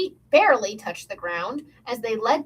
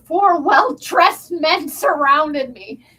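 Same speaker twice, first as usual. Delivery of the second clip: slow, loud, raised narration; dramatic reveal; 4 semitones higher, 6 dB louder, much slower than usual. A narrator's reading. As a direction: draw it out loudly for suspense.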